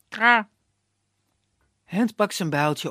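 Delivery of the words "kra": high, loud crow cry; crow caw. Acted. The bird cawing as it flies up, loud caw.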